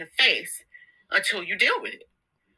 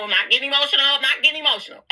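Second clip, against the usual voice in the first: higher and louder, voice pushing through emotion; insisting she's fine while choked up; real emotion, quick and strained; holding back tears; 7 semitones higher, 6 dB louder, much faster than usual. Real, not acted.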